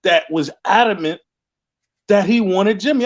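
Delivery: ordinary talk, nothing marked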